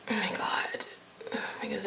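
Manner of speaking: breathless whispered "oh my god"; awed whisper. Real, not acted. To viewers, smelling cocoa butter: hushed awed whisper. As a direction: whisper it in awe at the scent.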